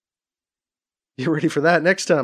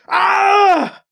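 high, loud sustained scream; scream of pain; 8 dB louder than usual. A narrator's reading. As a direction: scream out in agony. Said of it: "Alibi screamed".

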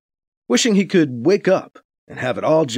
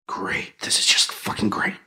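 whispered sarcastic gripe; bitter whisper; 5 semitones lower, 5 dB quieter than usual. Acted. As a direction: hiss it under your breath. Muttering frustration.

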